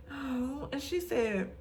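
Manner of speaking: ordinary talk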